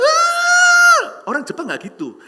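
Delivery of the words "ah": piercing high shriek, far louder; a woman's startled scream mid-restaurant; sudden high scream. Retold or imitated. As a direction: let out a sharp startled scream. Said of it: "A I Jepang itu teriak".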